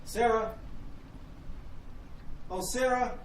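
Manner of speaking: calling out. Acted. Husband searching the graveyard for his wife, calling out. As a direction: call out for someone you can't find.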